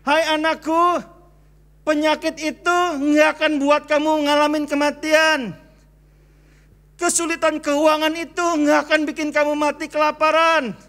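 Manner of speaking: a hint of God speaking tenderly to his child; God comforting a struggling believer, lightly voiced. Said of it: "Tuhan bilang gini".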